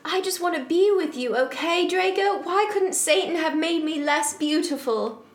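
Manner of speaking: high-pitched, mildly angry melodrama; hammed-up outburst of anguish; angry shout. Heroine lamenting her beauty to Draco, theatrical angry outburst. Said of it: "I shouted angrily".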